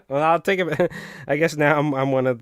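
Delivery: sheepish laughing retraction. Real, not acted. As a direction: laugh it out as you give in. Repeating a concession with a laugh.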